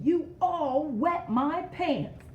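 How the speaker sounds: high, loud, slow exclamation; exclaiming. Acted. Showing kids the exclamation point, loud accusing cry. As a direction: shout the blame out at everyone. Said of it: "So he is exclaiming".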